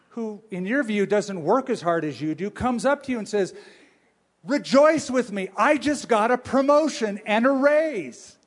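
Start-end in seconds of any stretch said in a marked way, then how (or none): 4.4-8.4 loud, high-pitched mock jubilation; elated announcing. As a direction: crow about your good news to a colleague. Acted coworker announcing good news.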